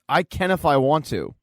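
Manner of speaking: plain reading voice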